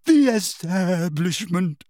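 slow, drawn-out seer's pronouncement; theatrical quavering declamation; dramatic, wavering. A narrator's reading. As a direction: intone it like a wounded prophetess. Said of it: "in a deep, dramatic, wavering voice".

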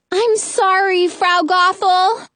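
high, drawn-out apology; high girlish call, stretched at the end. A narrator's reading. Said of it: "Rapunzel calls down".

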